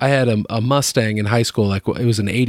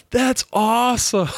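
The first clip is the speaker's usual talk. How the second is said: smug delight; 12 semitones higher, slower than usual. Retold or imitated. Teen self answering the teacher.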